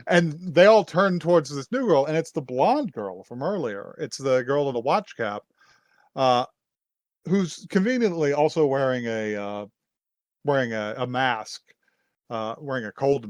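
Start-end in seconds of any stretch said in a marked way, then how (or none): none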